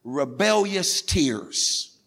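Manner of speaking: ordinary talk, nothing marked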